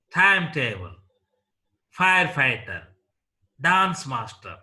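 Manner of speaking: "Timetable", "firefighter", "dance master" stressed on the first part; stress on first noun. Shown as a demonstration. Teacher listing compound nouns, first part stressed in "Timetable", "firefighter", "dance master". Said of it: "the first part is stressed here".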